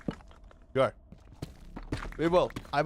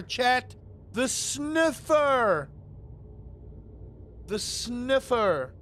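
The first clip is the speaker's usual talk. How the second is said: high, loud, drawn-out hype about the sniffer; hyped sniffer; 7 semitones higher, 4 dB louder, slower than usual. Real, not acted. Gloating to chat about the sniffer, high and loud. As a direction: crow about your diamond nose, stretching the words.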